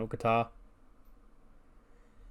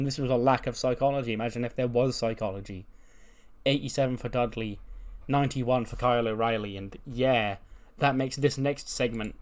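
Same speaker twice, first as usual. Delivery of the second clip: normal talking voice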